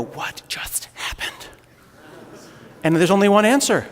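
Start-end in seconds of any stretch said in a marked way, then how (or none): 0.0-1.5 hushed, slow, breathless whisper. Retold or imitated. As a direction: breathe it out like a stunned user. Confused users after slider bar.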